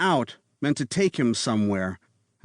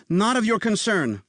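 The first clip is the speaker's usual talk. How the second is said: high, sharp retort; mother snaps at the nanny to stop asking; snapped; 10 semitones higher than usual. A narrator's reading. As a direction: cut her off coldly. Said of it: "Sarah snapped".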